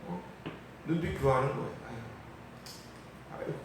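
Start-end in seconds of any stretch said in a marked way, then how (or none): 1.9-3.5 a soft breathy tut of contempt; imitating a sneering onlooker's sigh; mock scornful sigh. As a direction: sigh at him like a smug mocker. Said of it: "이러면서".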